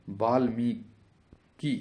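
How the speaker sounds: Valmiki in slow, separate syllables; very slow sounding-out. Shown as a demonstration. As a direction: draw out Valmiki, pausing before the last syllable. Teacher sounds out the spelling. (Valmiki को बहुत धीरे तोड़कर)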